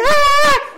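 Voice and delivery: very high, loud answering scream; hammed-up shriek of panic; re-enacted scream. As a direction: scream back in mock terror. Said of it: "And I'm going".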